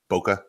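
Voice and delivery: "bokeh" with a weak "uh" ending; pronunciation demo. Shown as a demonstration. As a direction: say "bokeh" as BOH-kuh. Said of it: "it's been pronounced seven different ways".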